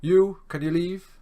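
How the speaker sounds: slow, loud mock lecturer command; stern order. Lecturer slowly and loudly ordering a student out of class. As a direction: bark the order out slowly like a strict teacher.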